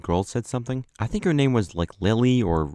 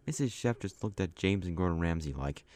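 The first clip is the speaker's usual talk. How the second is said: ordinary delivery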